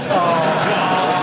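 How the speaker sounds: big, loud burst of laughter. Real, not acted. Streamer failing not to look smug, laughing loudly.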